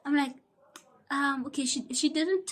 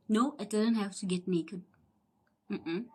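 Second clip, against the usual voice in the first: ordinary delivery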